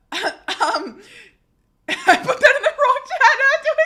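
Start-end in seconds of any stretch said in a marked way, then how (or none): 0.1-3.8 loud, high squeal while laughing; real laughing fit. Real, not acted. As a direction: confess it through helpless laughter. Laughing at own chat mistake.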